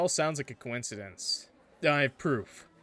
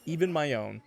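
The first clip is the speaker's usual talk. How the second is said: ordinary delivery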